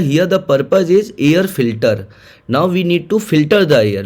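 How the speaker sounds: very slow, deliberate stress on the purpose; teacher underlining the key idea; slow emphasis. Shown as a demonstration. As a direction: drive home the point word by word. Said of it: "But now the".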